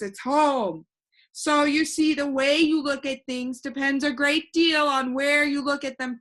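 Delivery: put-on a cheerful know-it-all boy floating in the air; voiced boy Alec. Alec explains viewpoint.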